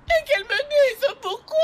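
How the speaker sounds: a distraught mother shrieking through tears; sobbing shout. Acted. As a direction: burst into a tearful scream.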